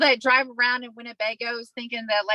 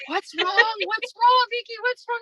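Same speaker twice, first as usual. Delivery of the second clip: high-pitched urgent questions, imitated; alarmed guard; 11 semitones higher than usual. Security guard running up to the yelling patient, alarmed. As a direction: fire off worried questions, voice raised.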